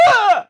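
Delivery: very high, loud strained yelp; imitated jump yell. Imitating Nathan Drake's impossible maneuver, high yell. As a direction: shriek it like a desperate leap, away from the mic. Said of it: "turn my head a full one hundred eighty degrees".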